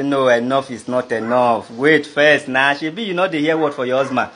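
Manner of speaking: low-pitched, restraining reply; retold firm objection. Husband's reply restraining his wife.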